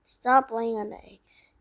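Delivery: ordinary talk, nothing marked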